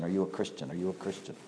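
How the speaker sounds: low, hushed asking, as if to the dying; soft, low repeated plea; quiet imitated question. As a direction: murmur it low, as if bending over the wounded.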